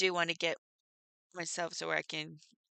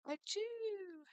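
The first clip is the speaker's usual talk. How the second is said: high mock sneeze, softer; playful pretend achoo; fake sneeze; 6 dB quieter than usual. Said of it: "I love our little joke".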